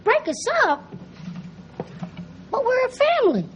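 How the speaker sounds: high, panicked disbelief; alarmed. Acted. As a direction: voice jumps up in alarm. Kids fear being taken away.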